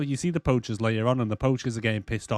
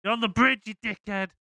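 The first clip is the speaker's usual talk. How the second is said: mock shouting; 9 semitones higher than usual. Claire yelling at the kid on the bridge, mock shouted, higher. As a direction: call it over like you warned them. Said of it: "kind of shouts over".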